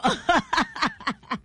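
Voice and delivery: laughing. Real, not acted. Laughs after joke.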